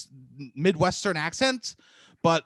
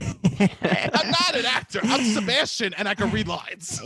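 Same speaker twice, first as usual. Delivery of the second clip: slow, high-pitched declaration; mock-indignant self-defence; hammed-up protest; 4 semitones higher, slower than usual. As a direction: draw it out, pitched way up. Repeats protest bigger and slower.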